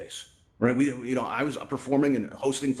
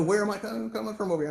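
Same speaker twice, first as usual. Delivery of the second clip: surprised; 9 semitones higher than usual. Retold or imitated. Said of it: "I go".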